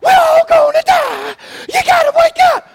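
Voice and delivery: high-pitched, loud, hammed-up panicked shrieking; frantic falsetto screaming for help; panic mode. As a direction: shriek like a terrified disciple in a mock panic. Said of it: "they're like panic mode".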